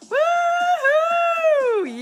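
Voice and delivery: high, loud celebratory woohoo; joyous whoop to the chat. Real, not acted. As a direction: cheer it out at the top of your voice.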